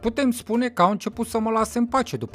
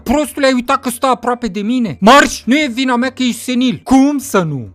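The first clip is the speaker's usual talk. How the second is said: heated bickering; 3 semitones higher, 7 dB louder than usual. Acted. Telling a friend off for being senile, heated and loud. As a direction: fire back loudly at a friend.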